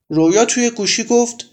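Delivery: plain reading voice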